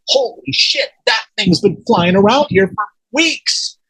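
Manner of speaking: high-pitched retold amazement, drawing out the end; stunned disbelief, acted out in the retelling; astonished. Man telling his wife what he saw, astonished.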